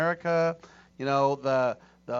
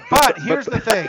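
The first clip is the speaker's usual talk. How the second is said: laughing; 8 semitones higher, 11 dB louder than usual. Real, not acted. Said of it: "Can't say that with a straight face".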